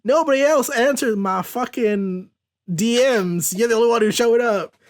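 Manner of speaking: high, delighted exclamation easing into chatter; high-pitched, gleeful greeting; happy Rogue. Acted. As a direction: cry out the name in high, happy relief. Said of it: "Rogue is happy and she's like".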